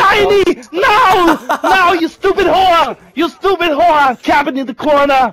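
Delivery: mock furious. Gamer screaming mock insults at teammate Steiny. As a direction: yell at your teammate in mock rage.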